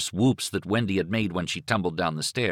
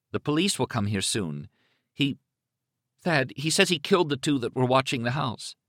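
close to tears; 6 semitones higher than usual. Acted. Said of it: "Now she sounded close to tears".

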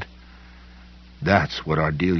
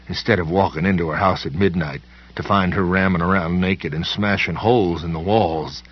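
ordinary delivery, nothing marked

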